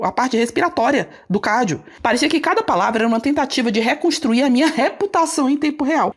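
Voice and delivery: ordinary delivery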